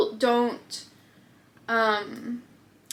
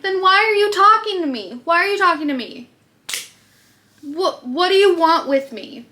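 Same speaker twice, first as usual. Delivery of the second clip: exasperated retort; 7 semitones higher than usual. Retold or imitated. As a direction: snap back at him in disbelief. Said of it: "I'm like".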